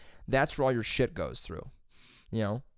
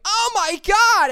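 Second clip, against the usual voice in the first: shrieked, much higher and louder, drawn out; a horrified scream at the news; losing her mind; 12 dB louder, slower than usual. Retold or imitated. Lady learns she's standing in sewage, horrified shriek. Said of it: "started losing her mind".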